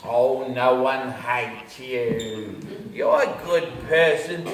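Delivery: low, drawn-out motherly reassurance; inner angel. Acted. Angel reassuring him someone likes him, slow cooing. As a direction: soothe him slowly as his angel. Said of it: "my angel will jump in".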